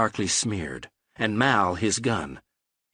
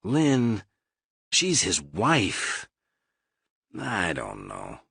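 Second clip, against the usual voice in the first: laborious; much slower than usual. A narrator's reading. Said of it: "he said laboriously".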